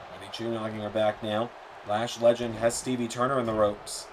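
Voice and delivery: usual speaking voice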